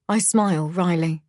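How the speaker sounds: ordinary delivery, nothing marked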